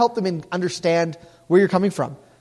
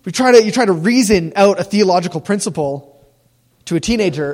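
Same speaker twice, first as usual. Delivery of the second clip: ordinary talk, nothing marked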